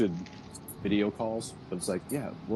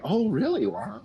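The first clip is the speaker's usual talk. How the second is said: high, fake-interested "oh really, wow"; pretend interest; 11 semitones higher than usual. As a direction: humor a small child. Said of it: "And you're like".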